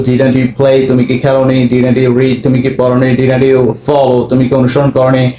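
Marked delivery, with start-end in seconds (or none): none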